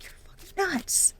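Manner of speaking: hushed, breathless aside; whisper. Retold or imitated. Reader voicing a viewer muttering about the suitor, whispered. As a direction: whisper it like a secret insult.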